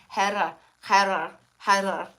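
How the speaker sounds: put-on Heather as Hera, German accent with a rasped h. Granddaughter imitates German Omi saying Heather, German accent. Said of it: "She could not say Heather with her accent".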